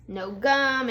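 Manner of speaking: usual speaking voice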